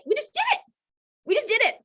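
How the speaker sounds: excited. Real, not acted. Speaker proudly recalling helping Kelly, high and thrilled.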